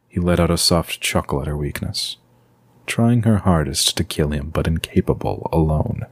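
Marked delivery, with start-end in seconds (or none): none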